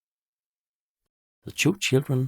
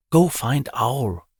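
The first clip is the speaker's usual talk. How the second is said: gasping; 5 semitones higher, 6 dB louder, slower than usual. Acted. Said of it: "Pointy gasps".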